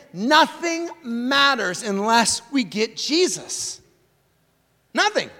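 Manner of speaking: slow, deliberate, every word weighted, "Nothing" hit hardest; "Nothing matters unless we get Jesus" spelled out slowly, leaning hard on "Nothing"; slow emphatic statement. Shown as a demonstration. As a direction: lay the line down slowly so it sinks in. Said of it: "key line stated slowly".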